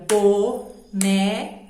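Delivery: "boneca" slowly as bo... ne... ca; "boneca" in three spaced beats; syllable by syllable. Shown as a demonstration. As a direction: beat out "boneca" as if clapping each syllable. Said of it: "Outra maneira é batendo palma".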